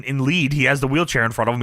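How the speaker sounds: usual speaking voice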